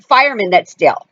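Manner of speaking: ordinary talk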